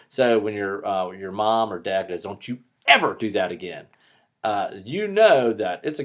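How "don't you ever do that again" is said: "ever" punched hard in a parent's scolding; stress on EVER. Acted. Parent telling off a child in trouble, stress on "ever". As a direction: lean sharply on "ever", then let the rest fall. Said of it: "there's an inflection on the word".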